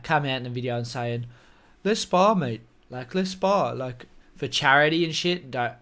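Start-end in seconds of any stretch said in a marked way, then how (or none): 1.8-3.7 rough, half-hearted impression of a British challenger; True Geordie. YouTuber challenging a fighter to spar, amateur impression. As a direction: try mimicking his accent, not very well. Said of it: "my impressions aren't the best".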